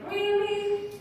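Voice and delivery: high-pitched, let-down "really"; a bit sad. Shown as a demonstration. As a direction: question it high, as unwelcome news. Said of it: "happy and a bit sad".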